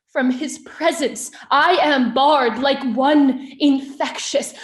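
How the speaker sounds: wronged queen defying her accuser, loud and impassioned; Hermione on trial. Acted. Accused queen answering her husband before the nobles, impassioned defiance. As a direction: plead the case in weighty, deliberate heightened verse, with long pauses.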